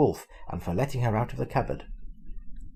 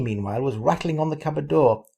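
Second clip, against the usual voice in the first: plain reading voice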